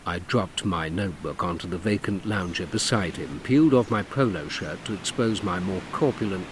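ordinary delivery

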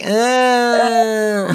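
long, high held whine; mock wail. Imitating Fran searching the house, mock wail. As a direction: hold one high droning cry.

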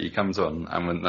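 ordinary talk, nothing marked

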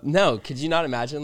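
usual speaking voice

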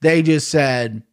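ordinary talk, nothing marked

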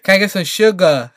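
hammed-up Southern request; mock Southern accent. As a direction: say it with an exaggerated Southern "sugar". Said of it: "starting to talk a little Southern".

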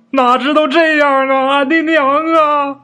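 high, slow wailing cry for his mother; wailing lament. Acted. Grieving son wails to his dead mother, high slow sobbing. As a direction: drag the words out in a sobbing wail.

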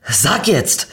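lauter, höherer Befehl; wütendes Schreien. A narrator's reading. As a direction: eine Antwort zornig einfordern. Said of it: "schrie Niki weiter". (zornig herausgeschrien)

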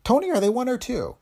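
high, anxious correction and question; rattled, high-pitched questioning; gasped alarm. A narrator's reading. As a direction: stammer on in shock, pitch climbing. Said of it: "Kathleen gasped".

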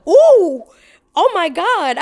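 loud high shocked cry; shocked gasp. Retold or imitated. Shock at the neighbor's wife.

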